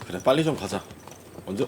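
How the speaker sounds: ordinary talk, nothing marked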